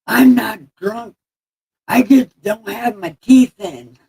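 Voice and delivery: high, loud, slow slurred toothless voice; imitating the toothless guy's mushy speech; toothless drunk-sounding man. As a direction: mimic a guy with no teeth in, slow and slurring. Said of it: "And he sounded drunk".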